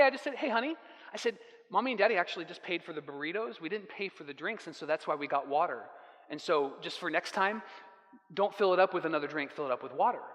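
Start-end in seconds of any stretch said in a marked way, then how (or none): 0.3-0.7 put-on soft, gentle call to a child. Dad softly gets his young daughter's attention, gentle. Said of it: "in the nicest, kindest, most compassionate way". 1.7-10.2 softer, lower, fast gentle explaining. Retold or imitated. Dad explains the drinks rule to his daughter, softly. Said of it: "in the nicest, kindest, most compassionate way".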